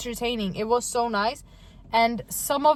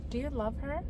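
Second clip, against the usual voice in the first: hushed, intense question; 16 dB quieter, faster than usual. Retold or imitated. Retelling Vanessa confronting a crying woman, hushed and intense. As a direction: ask it very softly, as an imitation of her.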